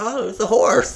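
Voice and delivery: high, cooing, laughing discovery; mock delight. Visitor walks up to the colt, mock naive delight. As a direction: pitch it up in naive wonder. Acts own delight in stall.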